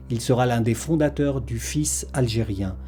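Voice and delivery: usual speaking voice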